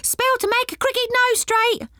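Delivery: high squeaky pixie calling out wares; pixie hawker cry. Acted. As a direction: sing it out like a tiny market pixie. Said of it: "crying their wares at the top of their voices".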